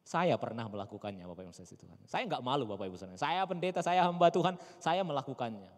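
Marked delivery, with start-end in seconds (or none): none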